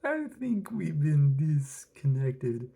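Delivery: slow, loud, theatrical villain line; cartoon villain drawl; Joker voice. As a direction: deliver it with mock menace. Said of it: "He's like".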